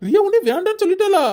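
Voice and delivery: high, stunned disbelief; shocked. Acted. Grandmother hears the child rejected her mother, shocked and high. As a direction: ask it as if the news hit you.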